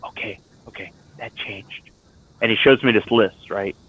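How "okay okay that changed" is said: hushed, much softer confiding voice; quieter tone. Retold or imitated. VFW recruiter lowering his voice to the speaker: hushed confiding tone. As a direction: drop to a near-hush, as if sharing a secret. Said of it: "he starts talking in a quieter tone".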